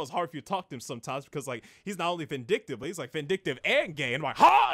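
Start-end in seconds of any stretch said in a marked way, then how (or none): 4.4-4.7 very high, loud yelp; shocked. Retold or imitated. Viewer hears the friend's revelation about the boss, shocked. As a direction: burst out in comic disbelief.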